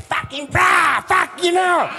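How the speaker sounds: loud, high mock rant of a hothead; aggressive local. Surfer imitating Johnny Boy yelling after the wipeout, loud mock rant. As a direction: bark it like the local tough guy. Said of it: "Johnny Boy's like".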